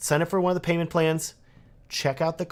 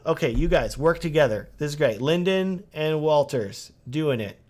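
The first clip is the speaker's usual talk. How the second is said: ordinary delivery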